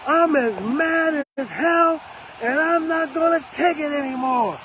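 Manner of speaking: telling listeners to throw open windows and yell, shouted. Retold or imitated. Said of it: "open up your windows and yell out".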